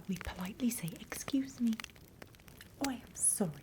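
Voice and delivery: ordinary delivery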